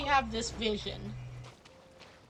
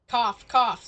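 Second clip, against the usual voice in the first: mock cough; 5 dB louder than usual. Pointed hint before a name.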